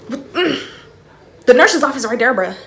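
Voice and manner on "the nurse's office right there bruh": loud, high urging. Retold or imitated. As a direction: push her toward the office. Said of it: "urging her".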